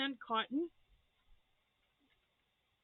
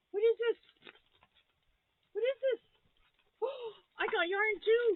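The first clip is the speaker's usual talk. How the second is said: higher and louder, gasping with delight; excited squeal; 11 semitones higher, 5 dB louder than usual. Real, not acted. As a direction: squeal over each gift, real joy. Gasping at gifts.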